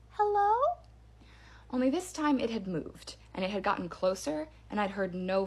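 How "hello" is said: high, louder doll-like "Hello?"; creepy doll voice. Retold or imitated. Retelling the voice calling again, creepy doll call. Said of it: "the voice said again".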